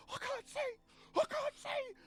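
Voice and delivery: very high, squealed cry of mock panic; drawn-out falsetto wail, hammed-up; panicking blinded pirates. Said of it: "Some of them reach for their eyes and are like".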